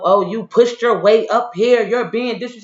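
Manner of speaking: mock the guard's accusing tone, slowed down; aggressive guard. Mocking the aggressive guard who accuses her of pushing in. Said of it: "he just so aggressive".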